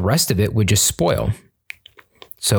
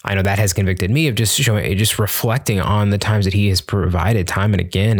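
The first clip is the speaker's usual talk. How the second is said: usual speaking voice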